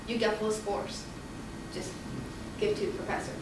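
hushed, almost breathless; like a voice speaking inside her; the voice in her heart, hushed; quiet inner voice. Retold or imitated. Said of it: "I hear a voice in my heart".

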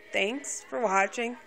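slow, flat monotone sign-off. Shown as a demonstration. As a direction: drone it out in a dull monotone. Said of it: "Putting out my monotone voice".

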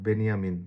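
বেনিয়ামিন repeated as "Ben-ya-min". Shown as a demonstration. Teacher confirming the German name, J as Y. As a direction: glide through বেনিয়ামিন with y, no j. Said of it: "জে কে ওয়াই এর মতো".

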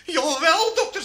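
high, loud mock protest; protesting patient. Woman retorts to doctor. (hoog en luid protesterend)

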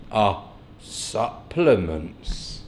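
playfully slow-motion "Our supplements", pausing after "Our"; drawn out. Pretend. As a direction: stretch out "Our supplements" syllable by syllable. Repeats the words with mock slowness.

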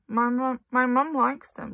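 frightened stammer, slow; stuttering. Acted. Amanda stammering to Trunchbull, mildly frightened. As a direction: plead in fear. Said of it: "managed to stutter".

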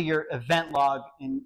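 ordinary talk, nothing marked

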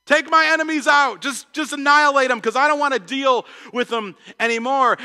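mock the angry churchgoer demanding revenge; vengeful plea. Congregant venting at God about enemies, hammed-up angry plea. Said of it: "Anybody ever felt that way?".

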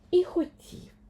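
usual speaking voice

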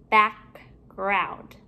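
BACKGROUND with a gap between "back" and "ground"; two separate parts. Shown as a demonstration. Teacher building compound words for pupils, halves kept apart.

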